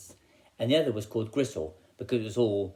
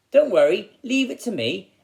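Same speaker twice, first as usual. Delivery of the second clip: high, louder plucky reassurance; confident boy; 10 semitones higher, 8 dB louder than usual. Acted. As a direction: sound brave for his sister. Hansel reassures sister in the dark.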